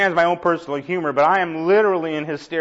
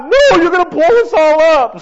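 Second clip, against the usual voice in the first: high, loud frantic shout; mock panic; 11 semitones higher, 10 dB louder than usual. Warning the kid dialing by the pumps, panicked yell. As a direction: scream the warning in comic terror. Man panics as phone is dialed.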